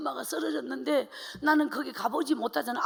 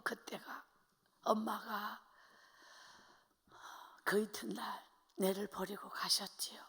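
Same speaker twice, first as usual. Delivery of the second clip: grief-stricken near-whisper, much softer and lower; choked whisper; 5 semitones lower, 12 dB quieter than usual. Real, not acted. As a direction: barely get the words out. Recalling mother's death, voice collapsing. (목이 메어 속삭이듯)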